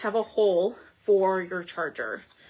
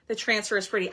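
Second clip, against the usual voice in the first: ordinary talk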